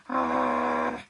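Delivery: real loud laugh, burst out. Real, not acted. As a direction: laugh through the brush. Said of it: "This is funny".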